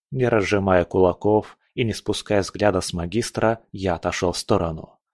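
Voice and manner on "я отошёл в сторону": usual speaking voice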